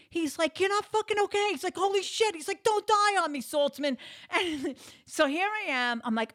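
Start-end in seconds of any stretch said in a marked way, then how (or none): 0.6-1.5 very high, loud imitated panic; panicked husband. Panicked husband contradicting his collapsed wife, high alarmed shout. 1.8-2.3 very high, loud imitated panic; panicked husband. Panicked husband contradicting his collapsed wife, high alarmed shout. 2.6-4.0 high, loud hammed-up plea; panicked husband. Husband begging his collapsed wife to hang on, panicked shout.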